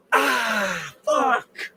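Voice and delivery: high cry of pain; pained yelp. Real, not acted. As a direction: yelp when stung. Stung yet again.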